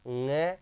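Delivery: ng held as a single nga from the nose; nasal pronunciation. Shown as a demonstration. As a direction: model ng slowly as nasal nga. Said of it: "तो ये तो क्या बोलेगा?". (ng को नाक से "ङ" बोलकर दिखाएँ)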